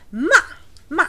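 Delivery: curt ma dropping from up to down; strict angry ma. Shown as a demonstration. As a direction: say ma like a little scolding. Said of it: "a very strict little angry sound".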